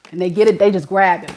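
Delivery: normal talking voice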